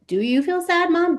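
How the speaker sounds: put-on high, questioning child voice; child asking. Child questioning mom about her sadness, high. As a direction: ask like a puzzled little kid. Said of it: "But it's like".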